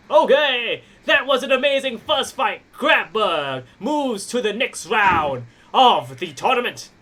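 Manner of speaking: hyped ring announcer; wrestling promo. Acted. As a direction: sell the match like a ring announcer.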